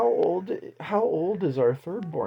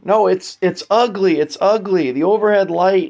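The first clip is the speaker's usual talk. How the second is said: called from the next room; from another room; 7 semitones higher, 12 dB louder than usual. Retold or imitated. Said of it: "I hear from the other room".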